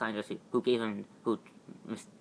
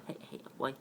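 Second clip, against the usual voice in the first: whispered coax; 3 semitones higher, 9 dB quieter, faster than usual. Acted. Softly calling the little dog.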